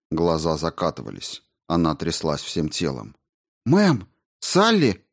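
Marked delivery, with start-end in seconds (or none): 3.7-5.0 high-pitched urgent cry; alarmed exclamation. A narrator's reading. Rao rushing to her collapsing partner, alarmed cry. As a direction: exclaim as you rush to help her. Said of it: "воскликнула Рао, бросаясь к ней".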